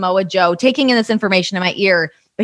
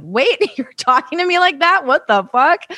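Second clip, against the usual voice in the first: high-pitched, incredulous retort; shocked disbelief; 6 semitones higher than usual. Retold or imitated. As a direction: act out being taken aback. Acting out her shocked reaction.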